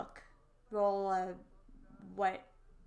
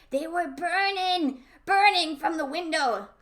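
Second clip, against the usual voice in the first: dramatic spooky; 7 semitones higher, 12 dB louder than usual. Acted. Spooky tale, loud and slow.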